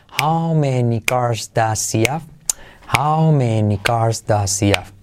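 slow, linked model sentence; repeat slowly. Shown as a demonstration. Teacher drills the sentence slowly for learners. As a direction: say it slowly for students to copy.

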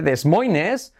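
DES MOINES as "des MOY-nes", rising at the end; Spanish spelling reading. Shown as a demonstration. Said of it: "así es como se escribe".

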